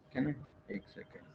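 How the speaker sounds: whispered under the breath; a quick whispered one second; whispered aside. Real, not acted. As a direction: mutter it to yourself while checking. Aside while checking answer.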